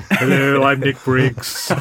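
Nick Briggs impression. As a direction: slowly impersonate your co-host introducing himself. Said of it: "the voice I hear in my head is yours, Nick, going".

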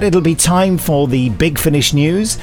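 ordinary talk, nothing marked

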